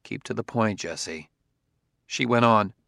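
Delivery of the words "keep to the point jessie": gentle. A narrator's reading. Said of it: "He said gently".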